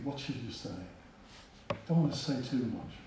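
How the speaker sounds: soft, hesitant inner voice; private thought. Retold or imitated. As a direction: think it through under your breath. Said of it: "And I'm thinking".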